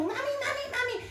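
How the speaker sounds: imitating her little son. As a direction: call like an eager toddler at dawn. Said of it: "Siempre está como".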